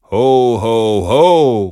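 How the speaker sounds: loud, drawn-out Santa laugh; booming Santa call. A narrator's reading. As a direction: boom it out like Santa. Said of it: "rufe ich".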